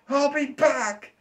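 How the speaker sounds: very high, slow squeaky threat; whiny vow of revenge. Retold or imitated. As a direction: stretch out the squeaky comeback vow. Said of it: "That person feels like".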